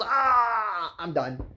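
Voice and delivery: high cry, then a flat sigh; yelp, then give up deadpan; mock dying shark. Shark hit by a plank, crying out and giving up. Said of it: "and the shark goes".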